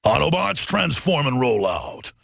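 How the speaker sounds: low, slow robot commander voice; deep heroic robot leader call; Optimus Prime impression. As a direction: give the order as a giant robot hero. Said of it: "What about an Optimus Prime?".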